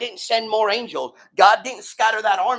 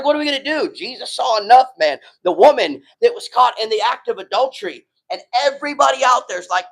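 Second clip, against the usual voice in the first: ordinary talk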